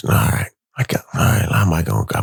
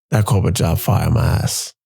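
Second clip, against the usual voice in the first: normal talking voice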